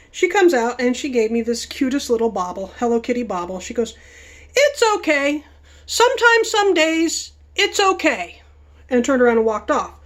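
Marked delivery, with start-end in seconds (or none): 4.6-8.4 much higher, sweet consoling mock voice; imitating the hostess. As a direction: soothe like the little hostess.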